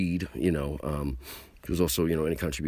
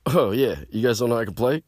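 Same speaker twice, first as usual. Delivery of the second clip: cocky retort; 7 semitones higher, 7 dB louder than usual. Retold or imitated.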